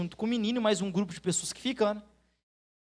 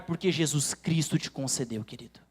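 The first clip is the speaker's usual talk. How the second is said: tender close; 6 semitones lower than usual. Acted. Preacher drops to tender close.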